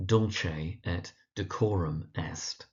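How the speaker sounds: slow Dulce et Decorum Est, stressing DUL and COR. Shown as a demonstration. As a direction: spell out Dulce as DUL-chay, unhurried, then de-COR-um. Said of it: "Emphasis on the dul and the cor".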